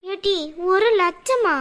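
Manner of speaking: shocked disbelief. Acted. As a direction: stretch it out, aghast at the huge sum. Shocked at huge loan request.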